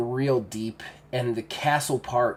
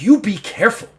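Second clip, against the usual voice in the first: loud, high orc threat; raised-voice menace; angry warning; 9 semitones higher, 9 dB louder than usual. Acted. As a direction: snap a warning at her. Orc threatens wife in quarrel.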